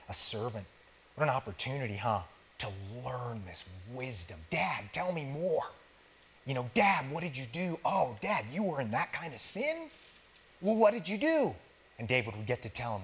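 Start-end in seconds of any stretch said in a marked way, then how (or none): 4.5-11.5 higher, eager curious son pestering his father. Acted. Young Solomon questioning his father David, eager higher voice. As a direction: play the boy begging for stories, climbing higher.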